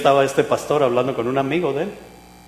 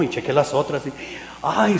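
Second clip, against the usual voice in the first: usual speaking voice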